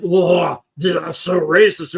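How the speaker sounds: put-on offended gasp, slowed; mock offended reaction. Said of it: "And now I'm not like".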